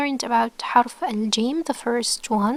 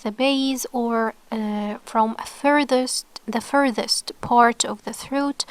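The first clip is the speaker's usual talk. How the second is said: ordinary talk, nothing marked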